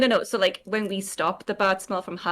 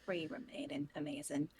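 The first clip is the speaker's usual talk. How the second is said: whispering; 5 semitones lower, 17 dB quieter than usual. Real, not acted. Whispered aside.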